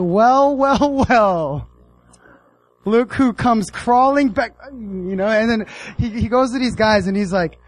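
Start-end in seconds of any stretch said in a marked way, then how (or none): 0.0-4.5 higher, louder, slow gloat with a laugh; smug gloating. Retold or imitated. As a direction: savour their coming back, mocking.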